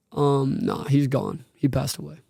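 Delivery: holding back tears as the words sink away; retelling the dad's news while choking up; emotional, voice fading. Real, not acted. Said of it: "Why is it hitting right now?".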